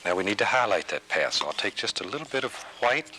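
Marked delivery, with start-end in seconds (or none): none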